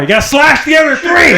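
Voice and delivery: high, laughing shout of the punchline. Real, not acted. Comic laughing at own punchline, high and loud. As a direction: crack up as you hit the twist.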